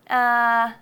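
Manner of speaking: lazy relaxed uh sound; thinking uh. Shown as a demonstration. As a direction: let a lazy uh drop out. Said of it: "so it's just really lazy".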